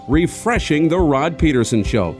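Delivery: ordinary talk, nothing marked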